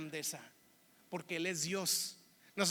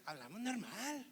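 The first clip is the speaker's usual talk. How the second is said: soft mocking echo in regio accent; teasing, hushed regio mimicry; mimicked Monterrey accent; 9 dB quieter than usual. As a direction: parrot it back quietly in sing-song Monterrey accent. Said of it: "Y me hacen".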